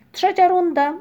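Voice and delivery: loud, high announcement; referee's call. A narrator's reading. Ryjek referees the cloud game, calling out. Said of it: "zawołał Ryjek, który sędziował".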